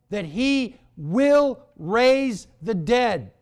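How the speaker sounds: loud, high, measured preaching; slow punches on the key words; emphatic preaching. Real, not acted. Preaches at full force.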